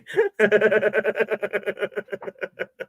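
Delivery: high, rattling run of laughter; long laugh. Real, not acted. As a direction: laugh on and on, trailing off. After joking about the wrong capital.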